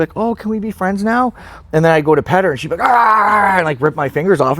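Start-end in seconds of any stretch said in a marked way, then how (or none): 0.2-1.3 high-pitched coaxing question; hopeful pet talk. Retold or imitated. Retold hopeful question to dog. 2.9-3.6 loud, high mock snarl; sudden loud noise of a snapping dog; imitated dog attack.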